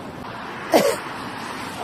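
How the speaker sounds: hacking cough from the gas; coughing fit. Real, not acted. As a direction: cough through stinging smoke. Tear gas in the air.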